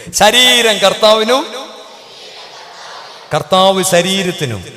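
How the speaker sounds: loud call-out. Shown as a demonstration. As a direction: belt it out for the sleepy crowd. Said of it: "ഒച്ചത്തിൽ പറയാൻ പറഞ്ഞപ്പോൾ".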